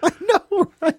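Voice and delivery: a high laughing "I know". Real, not acted. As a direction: squeal it out laughing. Laughing agreement.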